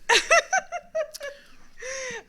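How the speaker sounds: high, loud burst of laughter. Real, not acted. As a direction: cackle out loud. Big laugh.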